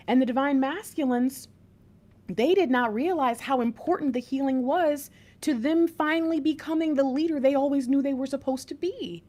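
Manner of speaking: normal talking voice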